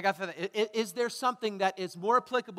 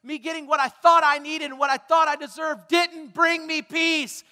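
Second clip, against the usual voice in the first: raised, loud voice of frustration; shouting out; 5 semitones higher, 8 dB louder than usual. Acted. People angry at injustice, crying out loudly. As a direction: pour out the complaint loudly. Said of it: "Who are still shouting out".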